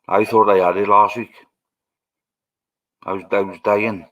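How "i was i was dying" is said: ordinary delivery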